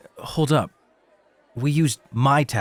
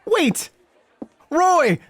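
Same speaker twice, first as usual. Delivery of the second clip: much higher, louder call to stop him; calling out after him; 4 dB louder than usual. Acted. Left alone with the guest, calling Roy back. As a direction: shout after him before he's gone.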